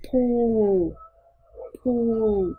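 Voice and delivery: "Pool" with long oo into a curled L; curled-tongue final L. Shown as a demonstration. Teacher showing the final L, "Pool" slow with tongue curled. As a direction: draw out "Pool", curling the tongue at the end. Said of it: "chúng ta cuốn lưỡi".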